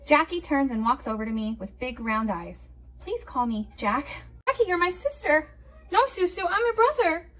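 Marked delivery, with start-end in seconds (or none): 3.0-4.1 pleading. Acted. Child asks softly. 4.5-7.3 put-on high earnest child voices; kid voices. Siblings argue.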